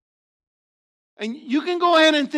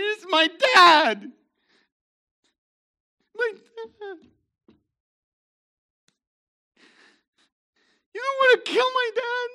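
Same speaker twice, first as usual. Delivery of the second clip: anguished son; 11 semitones higher, 5 dB louder than usual. Retold or imitated. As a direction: plead like a son begging for his dad's life. Joseph pleading about his father.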